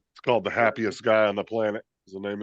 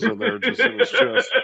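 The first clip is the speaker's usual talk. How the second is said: laughing; 11 semitones higher, 4 dB louder than usual. Real, not acted. Joking, laughing.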